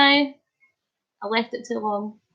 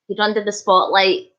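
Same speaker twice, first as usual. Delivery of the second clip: normal talking voice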